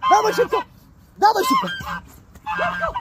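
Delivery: very high, eager hurry-up calls; high urging call. Real, not acted.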